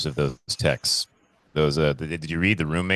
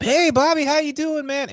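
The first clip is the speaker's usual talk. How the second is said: much higher, bright sing-song hello; mock cheery greeting; 4 dB louder than usual. Imitating himself greeting Bobby, in a high mock-cheery voice. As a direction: greet him like an overeager pal.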